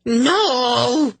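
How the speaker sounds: very high, drawn-out cry; horrified shriek. A narrator's reading. Kabat sees the claw rise before her, shrieking. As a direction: shriek in terror, voice shooting up. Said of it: "she shrieked".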